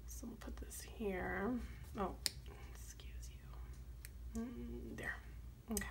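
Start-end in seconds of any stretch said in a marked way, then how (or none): none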